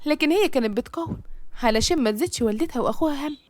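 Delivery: ordinary delivery, nothing marked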